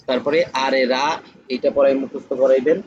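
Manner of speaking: ordinary talk, nothing marked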